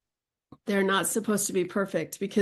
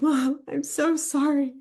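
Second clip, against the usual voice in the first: imitating daughter crying; 6 semitones higher, slower than usual. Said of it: "she started crying".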